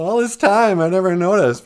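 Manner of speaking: high, loud realization; acted amazement. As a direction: exclaim as if it just dawned. Adam and Eve realize nakedness.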